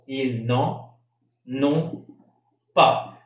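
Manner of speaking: Ils n'en ont pas said very slowly, each word apart; slow liaison demo. Shown as a demonstration. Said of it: "lo que es interesante es de ver la pronunciación". (pronunciación lenta y separada de Ils n'en ont pas)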